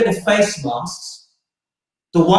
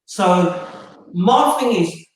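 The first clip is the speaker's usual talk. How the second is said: usual speaking voice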